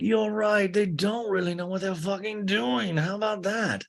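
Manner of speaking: high put-on voice, mock indignant; Lisa. Lisa agrees with Greg about the Toms, high imitation.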